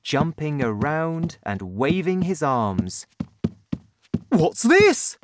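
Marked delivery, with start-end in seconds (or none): 4.3-5.2 high, loud startled question; father's high, excited exclamation; excited cry. A narrator's reading. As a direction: cry out in amazement, pitched well up. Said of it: "he cried".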